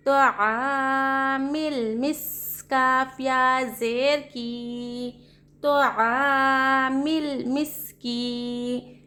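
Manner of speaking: slow, drawn-out sing-song letter spelling; slow spelling drill. Shown as a demonstration. Teacher spelling out Quranic words for students, slow sing-song chant. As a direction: chant each letter and vowel, then the joined syllables. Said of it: "हिज्जे करने में".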